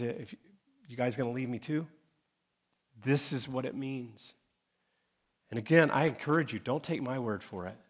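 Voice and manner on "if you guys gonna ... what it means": softer, gentle challenge to the twelve; Jesus quietly asks his twelve, slow and soft; quiet, slow question. Retold or imitated. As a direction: hush it down and ask them plainly. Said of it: "He turns to his twelve and says".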